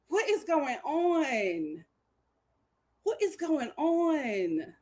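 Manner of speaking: slow, soft, drawn-out question; stunned disbelief. Retold or imitated. Host baffled by Taylor's new interest, slow stunned question. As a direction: stretch it out softly, dumbfounded.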